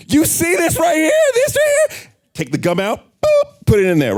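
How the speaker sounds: jab at the hole like a man taking charge; the force. Acted. Speaker playing a passenger who plugs the leak, high and loud. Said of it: "The force says".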